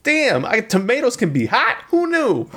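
high Brooklyn Italian guy; Brooklyn accent. Acted. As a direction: marvel like a Brooklyn cook. Continuing the Italian bit.

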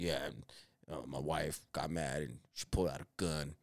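low, soft, easy smoker's voice; imitating coworker Mike. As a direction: lay the tall tale on in his low voice. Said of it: "he spoke like that".

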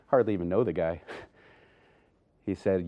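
ordinary talk, nothing marked

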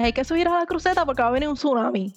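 fast, urgent words, tumbling out at speed; rushed, fast panic. Retold or imitated. A frightened girl rushing to tell everyone to flee, fast. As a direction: blurt it out quickly like a panicked girl. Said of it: "Así rápido".

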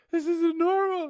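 high, loud panicked shriek. Retold or imitated. Scared seatmate shrieking to the air hostess, high and loud. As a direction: shriek it in terror. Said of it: "She's like".

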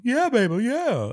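very high, loud funk-star impression; hammed-up high funk voice. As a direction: squeal it like a funk showman. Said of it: "That's how he sounds".

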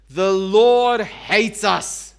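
slow, loud bitter complaint; a hammed-up whine of the grumblers; grumbling Israelites. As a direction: drag out the accusation against God. Israelites grumbling in their tents.

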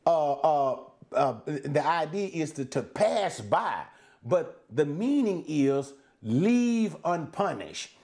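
ordinary delivery

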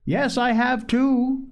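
put-on high, drawn-out childish retort; sturdy insistence. A narrator's reading. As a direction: insist stoutly, pitched high and slow. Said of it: "sturdily marching up to her".

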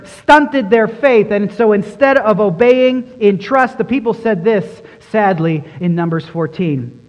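ordinary talk

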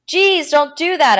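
high, loud, drawn-out scolding; snapped. A narrator's reading. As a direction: snap at someone who startled you. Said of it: "I snapped".

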